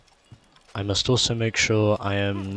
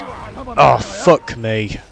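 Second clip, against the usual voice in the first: sudden loud shocked cry; startled outburst; 6 dB louder, much slower than usual. Real, not acted. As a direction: blurt it out in surprise. Sudden scare while sneaking past guards.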